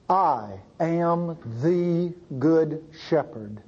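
slow, measured quote with space between words; solemn declaration. Retold or imitated. Preacher quoting Jesus to those who knew shepherds, solemn and slow. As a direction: deliver it slowly and weightily, word by word.

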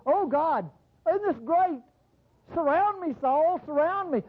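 mock a gushing charismatic prayer; mocking charismatic believer. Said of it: "that's what I hear in charismatic, stupid circles".

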